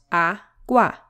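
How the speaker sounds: "agua" split into two beats, a, gua; syllable by syllable. Shown as a demonstration. Teacher showing learners the two syllables, syllable by syllable. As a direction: sound out "agua" in separate syllables.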